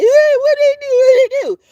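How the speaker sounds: high, loud calling voice; Mammy calling. Retold or imitated. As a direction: call it out high like a nosy neighbor. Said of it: "Here come Mammy".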